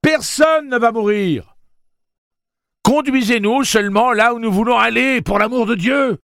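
very high, loud pleading voice; exasperated plea. A narrator's reading. Susanna pleading with the townsfolk, high and loud. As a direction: beg them, at the end of her patience.

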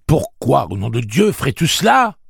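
angry demand. Acted. Eddie confronts Blaine: loud outraged demand. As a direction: demand an answer, rising in outrage. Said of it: "demanda-t-il".

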